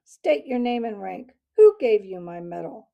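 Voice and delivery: loud, hard military demand; snapped, flat hard voice. A narrator's reading. Owl captain interrogates the mouse, snapping loudly. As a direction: snap it out like an officer challenging an intruder. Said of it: "snapped the owl in a l-, flat, hard voice".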